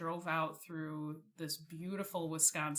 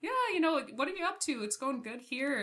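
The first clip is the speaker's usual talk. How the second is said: mom's voice, much higher and brighter; imitating mom; 8 semitones higher, 4 dB louder, faster than usual. Imitating her mom answering the phone, light and much higher. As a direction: play a breezy, cheerful mom on the phone. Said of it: "And she goes".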